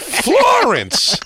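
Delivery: put-on high snapping old woman; old lady barking. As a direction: bark the sister's name. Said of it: "they'd be like".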